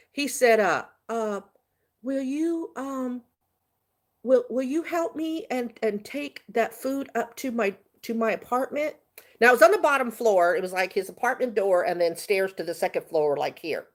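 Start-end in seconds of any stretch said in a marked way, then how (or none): 2.0-9.0 halting, slightly raised pleading imitation; hesitant passenger. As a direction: imitate a passenger timidly working up to a favor. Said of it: "He only talked like this".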